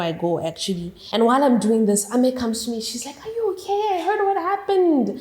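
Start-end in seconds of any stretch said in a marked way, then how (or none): 3.2-5.1 high, concerned friend checking in; caring friend. Retold or imitated. Friend Ame comforting her after the fight, high and concerned. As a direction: ask gently like a worried friend.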